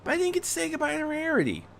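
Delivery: put-on high, quick boy's voice; high-pitched kid pointing it out; young boy protesting. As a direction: pipe up fast like an eager kid. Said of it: "Terry pointed out".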